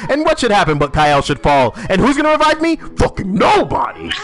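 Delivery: high, loud frustrated rant; angry outburst. Real, not acted. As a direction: vent at the team. Complains nobody revives him.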